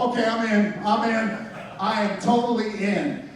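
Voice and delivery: high, emphatic commitment; eager surrender. Retold or imitated. New believer answering the Bible verse, eagerly all in. Giving in after reading the verse.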